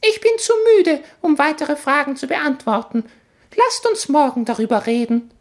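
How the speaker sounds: very high, squeaky smug tiny voice; clever baby voice. Acted. Baby in the suitcase boasts to the boys, very high. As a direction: brag like a know-it-all infant.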